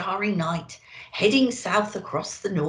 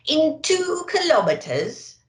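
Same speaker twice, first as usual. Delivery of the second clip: higher, slow, louder bossy sat-nav; bossy sat-nav lady; 8 semitones higher, 3 dB louder, slower than usual. A narrator's reading. Sat-nav lady directing Santa, higher and bossy. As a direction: read it like a bossy satnav. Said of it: "bossy lady voice".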